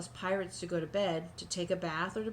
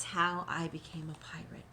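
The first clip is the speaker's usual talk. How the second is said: whisper; 3 semitones lower than usual. Real, not acted. Reading the title softly.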